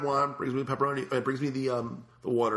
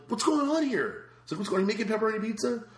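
high, raised mock outrage; incredulous demand; 11 semitones higher than usual. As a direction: confront the cooks, baffled. Confronting kitchen staff.

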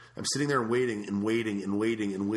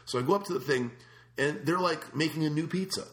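ordinary delivery

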